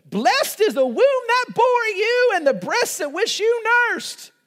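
high, loud, starry-eyed squeal; gushing woman in the crowd. Retold or imitated. Woman calling out to Jesus from the crowd, gushing high. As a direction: swoon over Jesus like an adoring fan. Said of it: "a woman in the crowd raises her voice and says".

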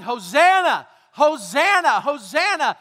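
loud joyful shout of Hosanna; crowd shouting. Acted. Crowd greeting Jesus on the road, shouting Hosanna. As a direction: call it out like the crowd in the street.

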